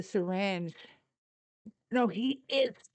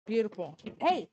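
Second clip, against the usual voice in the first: usual speaking voice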